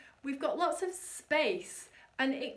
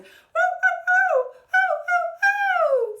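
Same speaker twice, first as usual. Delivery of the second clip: much higher, louder whiny puppy oh; puppy whimper; 11 dB louder, slower than usual. Shown as a demonstration. Coach imitating a puppy begging for attention. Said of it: "maybe put on those puppy eyes".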